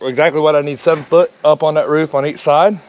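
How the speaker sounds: ordinary talk, nothing marked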